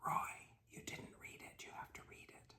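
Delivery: firm hushed whisper; whisper, pressing the point. Retold or imitated. Rector whispering firmly at the reader during service. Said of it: "And Mister Campbell said".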